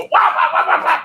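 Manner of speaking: loud, high wah-wah burst; sudden burst of chomping sounds; imitated cat attack noises. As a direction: fire off mock mauling noises. Imitating a cat killing someone.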